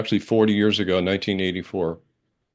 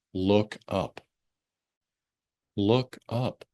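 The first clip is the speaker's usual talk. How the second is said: soft, lower and tender, like a kind guide; gentle loving voice; 3 semitones lower, 5 dB quieter, much slower than usual. Retold or imitated. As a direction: coax him softly to raise his eyes. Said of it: "very lovingly and gently said to me".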